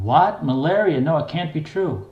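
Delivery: mother's shocked cry, half-screamed; screaming in disbelief. A narrator's reading. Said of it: "awake enough to start screaming".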